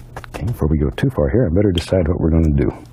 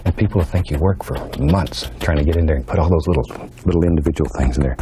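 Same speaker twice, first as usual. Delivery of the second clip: ordinary talk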